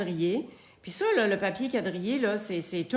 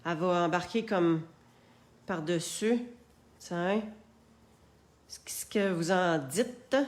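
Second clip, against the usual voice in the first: ordinary delivery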